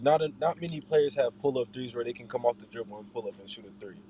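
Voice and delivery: normal talking voice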